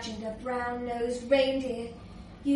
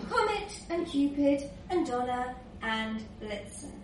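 usual speaking voice

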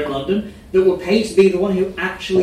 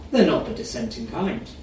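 ordinary delivery, nothing marked

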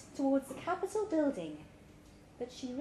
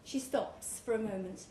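ordinary delivery

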